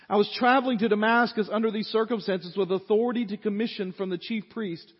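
loud, high preaching of the text; booming pulpit reading; preaching scripture. Acted. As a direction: proclaim it to the whole church. Preacher reads scripture at full force.

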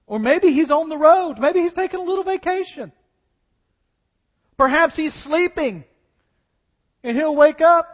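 high, loud mocking taunt; mocking trash talk. Elijah taunting Baal's prophets, mocking and loud. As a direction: sneer at the silent god. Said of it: "Elijah, the trash talker".

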